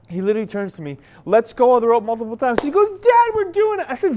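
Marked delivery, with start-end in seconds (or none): 2.9-3.9 put-on high, loud, drawn-out kid's shout; imitated excited kid. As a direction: mimic a son yelling gleefully to dad. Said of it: "Zion is so excited".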